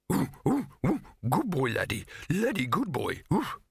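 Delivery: high yelping happy dog; excited dog yelps. Acted. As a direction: yip eagerly like a dim dog. Laddie's dog speech.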